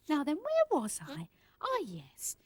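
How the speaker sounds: whispered to himself; muttered aside. Acted. Whisper to himself returning to his book. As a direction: murmur it under your breath. Settling back to reading.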